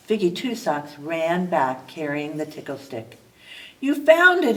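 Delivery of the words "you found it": louder, delighted exclaim. A narrator's reading. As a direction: bear thrilled his stick is back, delighted exclaim. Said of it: "exclaimed Jefferson Bear".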